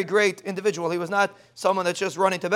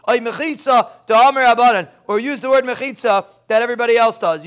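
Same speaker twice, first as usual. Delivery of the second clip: ordinary talk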